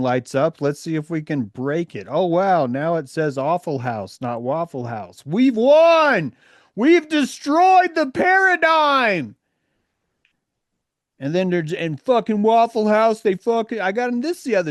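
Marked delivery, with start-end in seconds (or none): 5.2-9.4 sarcastic victory cry, high and loud; mock triumph. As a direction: cheer it like a hollow win. Sarcastic mock victory about bottles.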